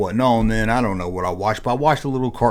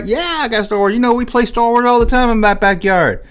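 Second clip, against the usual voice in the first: friend's voice; 11 semitones higher than usual. Retold or imitated.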